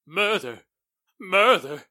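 shocked outburst. Acted. Business partner told of murder, shocked rising cry.

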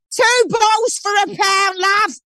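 high, shouted Cockney trader's cry; Cockney market call. Retold or imitated. A Cockney market seller shouting prices at passers-by. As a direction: bellow it like a Cockney stallholder. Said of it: "No, that's Cockney".

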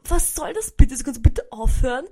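angry confronting. Retold or imitated. To the man photographing her, indignant loud demand. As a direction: tell him off.

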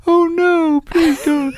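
squeaky high mock pleading; silly high voice. Shown as a demonstration. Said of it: "I don't do that voice".

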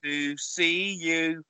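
put-on slow, gentle children's presenter; Justin Fletcher voice. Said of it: "my Justin Fletcher impression".

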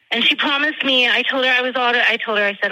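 ordinary delivery

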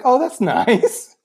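high, drawn-out mock approval; mock cooing. Everybody reacting to a deaf pageant girl, mock cooing. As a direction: stretch it out in a sweet imitated coo.

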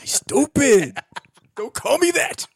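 high, loud comic scolding; hammed-up annoyed gang boss; mock indignation. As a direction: snap back in a high, exasperated pitch. Acted gang squabble over silly name.

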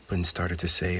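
ordinary delivery, nothing marked

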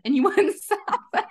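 high, quick words broken by a laugh; retelling the driver's words while cracking up; laughing through it. Retold or imitated. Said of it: "laughing as well".

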